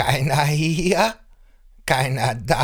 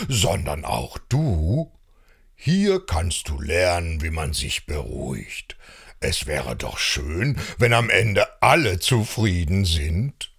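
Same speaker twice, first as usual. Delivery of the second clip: wise calm elder; 4 semitones lower than usual. Acted. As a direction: lower your voice and draw them in. Same wise low voice.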